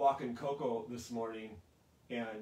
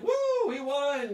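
high, loud drawn-out victory whoop; celebrating fan; 14 semitones higher, 11 dB louder, slower than usual. Acted. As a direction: cheer like the team just won. Acts out fans celebrating a last-minute win.

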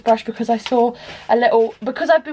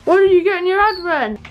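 high-pitched call to another room; 8 semitones higher, 3 dB louder than usual. Real, not acted. Vlogger calls to housemate about advent calendar, calling out.

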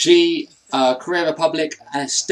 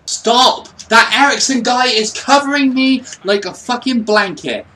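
loud, high-pitched rant; real frustrated yelling; exasperated outburst; 8 semitones higher, 5 dB louder than usual. Real, not acted. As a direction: burst out at the opponent, fed up. Raging at defender in game.

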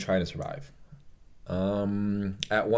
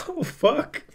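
laughing overwhelmed exclamation. Real, not acted.

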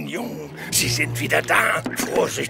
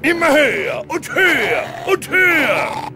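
high, loud, swooping repetition; frenzied storyteller building up; rising dramatic climax; 12 semitones higher, 3 dB louder than usual. Acted. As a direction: climb higher with each word.

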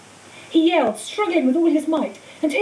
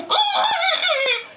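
pained scream. A narrator's reading. Quirrell in pain.

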